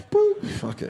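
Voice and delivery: high chirpy "boop" with a laugh; playful fake hang-up; mock hang-up. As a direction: tap it off like ending a call. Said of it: "I'd be like".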